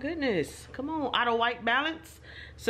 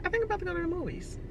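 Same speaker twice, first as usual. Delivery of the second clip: excited; 11 semitones higher, much faster than usual. Real, not acted. Thrilled at empty theater.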